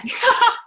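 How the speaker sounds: short high burst of laughter; a quick laugh at herself; bright laugh. Real, not acted. Laugh before repeating quote.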